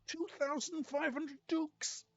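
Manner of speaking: rising high excitement; building excited repeat. Real, not acted. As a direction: gloat over the number. Excited about the loot.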